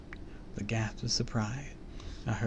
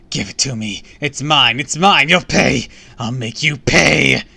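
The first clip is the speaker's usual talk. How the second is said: loud villain's rant building higher; vengeful cry; 4 semitones higher, 14 dB louder than usual. A narrator's reading. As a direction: build from a demand to screamed threats. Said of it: "With a cry".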